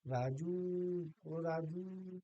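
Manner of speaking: राजू with the final ऊ drawn out; demonstrated call, long राजू on a rising note; calling someone. Shown as a demonstration. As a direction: call ओ राजू, stretching the last vowel. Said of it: "आवाज़ लगा रहे हैं किसी को".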